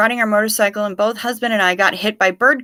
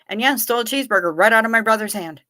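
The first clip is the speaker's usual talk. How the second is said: ordinary talk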